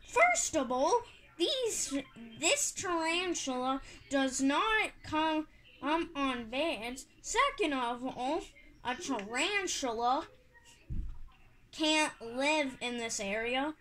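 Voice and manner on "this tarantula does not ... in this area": normal talking voice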